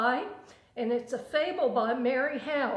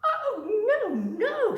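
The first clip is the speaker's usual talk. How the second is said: tiny fly squeaking a high, laughing no; a little fly laughing off the offer in a high squeak; 11 semitones higher, 7 dB louder, slower than usual. A narrator's reading. Fly refusing the spider, high-pitched and laughing. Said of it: "said the fly".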